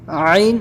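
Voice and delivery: "عین" squeezed from mid-throat; forceful throat letter. Shown as a demonstration. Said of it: "زور دے کے بولنا ہے".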